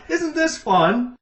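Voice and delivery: hammed-up mum faking fun over a board game; mother forcing cheer on her bored daughter; forced cheery mum. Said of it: "Jackie's like".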